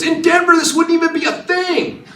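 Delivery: high, loud mock protest; going nuts. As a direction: yell it like an outraged suspect being arrested. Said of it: "he's going nuts in the car".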